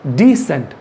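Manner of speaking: डिसेंट with a light d, then "sent"; डिसेंट, a light di then sent, the teacher's model; model pronunciation. Shown as a demonstration. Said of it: "इसका उच्चारण क्या होगा?".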